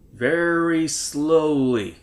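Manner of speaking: drawn out very slowly. Pretend. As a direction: stretch each word out. Deadpan slow comment.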